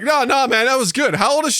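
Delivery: high-pitched, hurried-to-praise reaction; mock backpedaling listeners. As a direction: play the guys suddenly insisting it was good. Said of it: "cutting back to, like".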